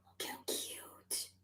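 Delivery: slow, hushed whisper of delight. Real, not acted. Admiring the tiny outfit, slow awed whisper. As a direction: breathe it out in awe.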